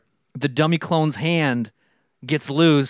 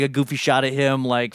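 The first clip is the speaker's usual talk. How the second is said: usual speaking voice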